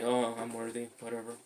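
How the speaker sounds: offhand, half-hearted, fading away on "whatever"; dismissive affirmation. Shown as a demonstration. Coach shows how not to say "worthy", dismissive. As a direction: toss it off as if you don't believe it. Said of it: "Not like".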